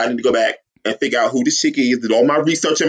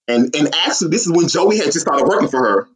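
usual speaking voice

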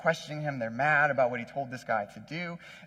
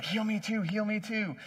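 higher-pitched mock pleading; eager pleading; 5 semitones higher, 3 dB quieter than usual. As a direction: act out begging for a turn, without shouting. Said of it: "I'd be running up to Jesus".

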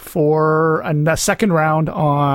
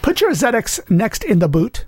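higher-pitched, botched British accent; bad British accent; 4 semitones higher than usual. Shown as a demonstration. Co-host tries British for his partner, botched accent that sounds Canadian. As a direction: try British and land nearer Canadian. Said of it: "now you sound, uh, Canadian".

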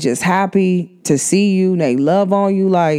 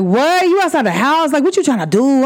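happy surprise; 7 semitones higher than usual. Retold or imitated. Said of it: "They be like".

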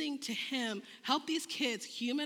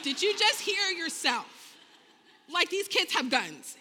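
loud, slow, drawn-out disbelief; incredulous protest; 7 semitones higher, 6 dB louder, slower than usual. Retold or imitated. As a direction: spell out the absurdity, loudly. Retold disbelief about guns in driveway.

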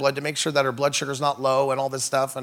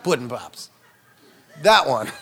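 Bill Cosby; 2 semitones lower than usual. Retold or imitated. Comedian shows which celebrity he means, lightly imitating him. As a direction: drop the voice for a mock TV pitchman. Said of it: "old Bill Cosby".

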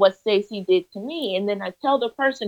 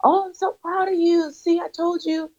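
much higher-pitched imitation of a delighted mentor; proud delight; 9 semitones higher than usual. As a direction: retell a mentor cheering on a pupil. Mentor's proud reply acted out.

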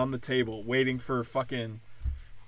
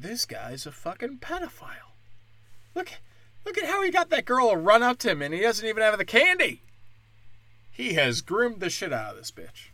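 higher, put-on scandalized voice; gossiping onlookers; 9 semitones higher than usual. Shoppers whispering about the server, high and scandalized.

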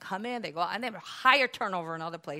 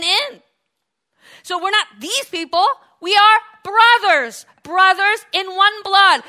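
full-force preaching; 8 semitones higher, 14 dB louder than usual. Real, not acted. Preacher uniting the congregation as brothers, full-force preaching. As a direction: build to a loud, high rallying cry.

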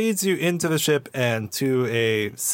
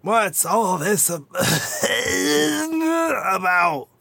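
put-on old Weequay; 9 semitones higher than usual. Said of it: "a very old Weequay poke his head out".

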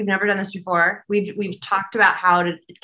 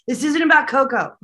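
raised, louder mock protest; relaying Hira's objection, higher and louder; quoted protest; 5 semitones higher, 4 dB louder than usual. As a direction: toss out the spirit's objection brightly. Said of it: "it was Hira saying".

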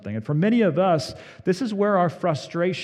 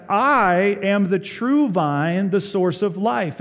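slow, high and loud, true lifted; voicing Jesus; 7 semitones higher, 5 dB louder, slower than usual. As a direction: proclaim it as Jesus, slowly, from the pulpit. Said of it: "Jesus says".

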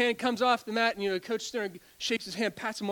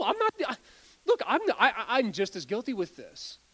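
ordinary delivery

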